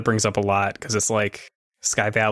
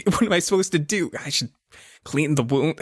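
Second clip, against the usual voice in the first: hammed-up fluster climbing higher and faster; flustered panic; 3 semitones higher than usual. Greg faced with a bleeding friend, acted panic. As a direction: start in dismay, build to high, stammering helplessness. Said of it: "And he's just like".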